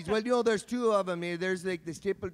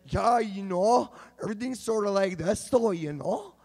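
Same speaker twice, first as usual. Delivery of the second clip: Kelowna dialect; 2 semitones higher than usual. Retold or imitated. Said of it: "that is a dialect".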